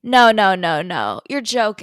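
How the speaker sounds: loud, pleading no no; loud disbelief. Real, not acted. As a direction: refuse to accept it. Refusing to believe news.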